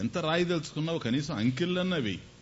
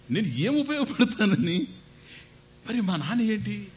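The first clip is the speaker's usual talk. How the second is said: put-on boy's humble question; 8 semitones higher than usual. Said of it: "నేను పోతే".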